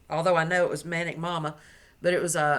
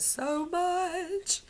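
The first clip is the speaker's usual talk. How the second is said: gushing; 11 semitones higher than usual. Real, not acted. Gushing over gift.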